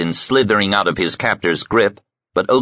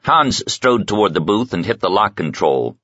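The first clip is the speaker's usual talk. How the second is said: usual speaking voice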